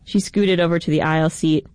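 plain reading voice

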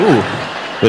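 normal talking voice

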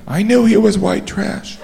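high, slow, hushed put-on voice; audience's inner voice, high and drawn out; judging onlooker aside. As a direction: murmur a judgement up high.

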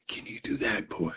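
put-on old master whispering a dare to the boy; whispered taunt. Old bluesman taunting young player.

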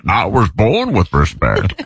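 low, slow Bane impression. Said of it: "It sounded like Bane".

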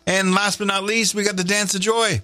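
high, hyped DJ voice; radio announcer. Acted. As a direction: sell it big. Radio host show promo.

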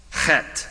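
"chet" opened with a guttural ch and closed on a hard T; "chet" with the ch scraped in the throat. Shown as a demonstration. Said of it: "pronounced with the guttural ch".

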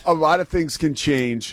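usual speaking voice

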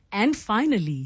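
imitating an anchor turning to good news; bright anchor "and finally"; upbeat news segue. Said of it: "you can hear the difference".

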